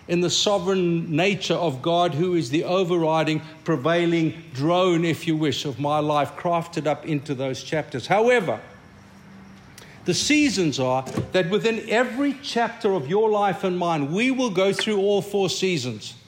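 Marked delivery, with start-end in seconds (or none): none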